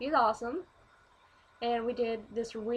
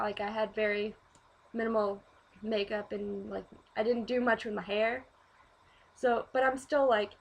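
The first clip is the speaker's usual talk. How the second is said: ordinary talk, nothing marked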